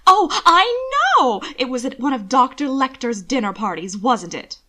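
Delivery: shrill, loud, high gush; excited socialite. Acted. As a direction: squeal it like gossip. Excited society lady exclaims.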